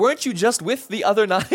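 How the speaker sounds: high, loud amused question; playful teasing. Pretend. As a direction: ask it grinning, half laughing. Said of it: "kinda laughing". (Jesus teasing the healed man with joy)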